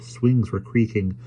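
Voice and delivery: usual speaking voice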